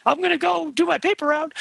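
very high, squeaky teen impression; cracking teenage voice. Panelist mocking their own voice loss, cracking teenage voice. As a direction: let it crack like an adolescent's voice. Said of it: "the teenage moments where I'm like".

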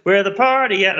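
lightly voiced party hype line; a hint of a rap hook; party song lyric. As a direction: toss off a club-track hook. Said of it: "a lot of, like".

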